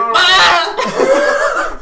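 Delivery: high loud goat bleat yell; goat scream. Retold or imitated. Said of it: "that's what goats sound like".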